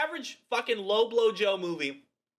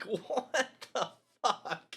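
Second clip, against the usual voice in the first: laughing disbelief; 3 semitones higher, 3 dB quieter, much slower than usual. Retold or imitated. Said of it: "I was like".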